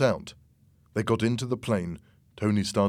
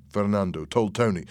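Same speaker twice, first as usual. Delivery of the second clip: usual speaking voice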